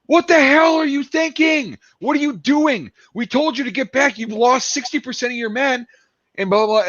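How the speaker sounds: high, furious tirade at the American; angry French commander. Retold or imitated. French commander scolding the reckless Americans, high outraged yell. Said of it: "the battalion commander goes up to the American battalion commander, and he goes".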